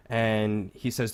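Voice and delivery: ordinary talk, nothing marked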